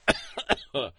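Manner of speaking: harsh choking cough. Real, not acted. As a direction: cough up the beer. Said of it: "Choking on my beer".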